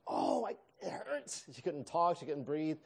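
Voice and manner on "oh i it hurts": higher, slow strained voice of pain; imitating wife in pain. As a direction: act out her hurting, slowly. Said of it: "she's like".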